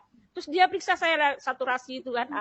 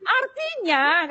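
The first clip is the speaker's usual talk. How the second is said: raised, very drawn-out lead word; emphatic explaining; 6 semitones higher, 4 dB louder, much slower than usual. Retold or imitated. Nurse answering the patient's question, imitated emphatic drawl. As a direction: stretch it out to build to the news.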